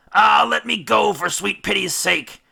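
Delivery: high, loud anguished plea; pleading groan. Acted. Captain strains against the ropes, groaning and begging. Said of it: "groaned Odysseus".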